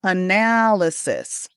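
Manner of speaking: "Analysis" slowly, with NAL stressed; stress on second syllable. Shown as a demonstration. Coach asking students to listen, stress on second syllable. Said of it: "stress on the second syllable".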